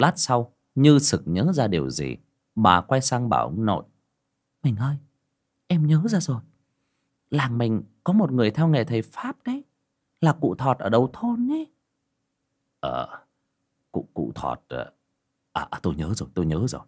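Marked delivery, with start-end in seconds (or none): 4.6-11.7 lightly voiced grandmother. Wife suddenly remembers a sorcerer. 12.8-16.8 put-on stammering old man. Stammering grandfather answers wife.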